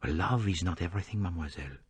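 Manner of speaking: put-on soft, gentle accented counsel; tender, quiet remonstrance. A narrator's reading. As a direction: say it gently, voice dropped in volume. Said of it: "Poirot said gently".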